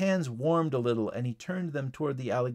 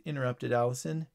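ordinary delivery

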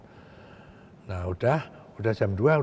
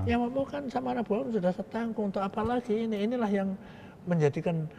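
raised pitch, softer, urging; persuading official; 10 semitones higher, 4 dB quieter than usual. Retold or imitated. Official urges the rebel to give up his gun, higher pitch. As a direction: press him to let the weapon go.

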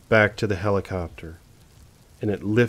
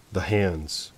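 hushed repeat; 2 semitones lower, 6 dB quieter than usual. A narrator's reading. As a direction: drop much softer on the hands. Narrator repeats, chill dawning.

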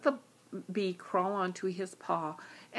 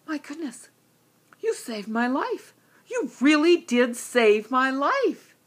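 amazed lion; 5 semitones higher, 6 dB louder than usual. Acted.

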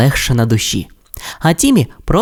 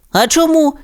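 Тім питає; 14 semitones higher than usual. Acted. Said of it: "спитав Тім".

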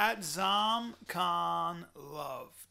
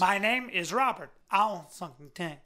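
robot with a nasal problem; 3 semitones lower, 3 dB louder than usual. Pretend. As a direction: play a robot with a blocked nose. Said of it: "a robot with a nasal problem".

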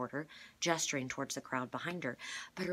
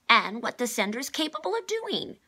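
high, loud urgent cry; 10 semitones higher, 10 dB louder than usual. A narrator's reading. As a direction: blurt it out, much louder. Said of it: "cried number two".

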